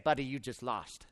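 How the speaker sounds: a private victory line kept inside; smug inner thought. Retold or imitated.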